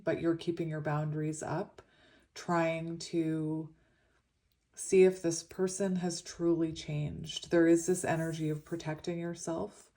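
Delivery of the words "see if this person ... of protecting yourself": usual speaking voice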